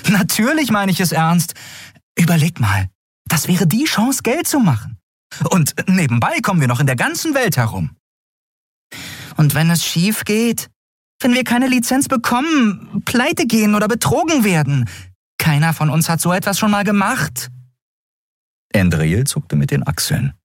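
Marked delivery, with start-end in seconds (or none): 0.1-8.0 very high, enthusiastic character voice; high-pitched, eager persuasion; eager Endriel. Acted. Voices Endriel pitching her plan. 9.4-17.5 very high, fretting companion voice; high-pitched, doubtful listing of dangers; worried Nelen. Acted.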